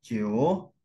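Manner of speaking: "Ciu" held long, dipping and then rising; full third tone. Shown as a demonstration. Teacher shows nine standing alone, "Ciu" down then up. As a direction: give "Ciu" its whole dip and climb. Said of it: "Misalnya kita sebutin nada tiga".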